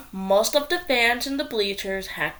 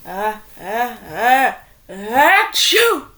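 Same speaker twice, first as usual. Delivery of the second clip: slow build of uh, uh, then a loud achoo; acted big sneeze; 6 dB louder, slower than usual. A narrator's reading. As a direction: wind up and burst into a sneeze. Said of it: "sneezed the cheerleaders".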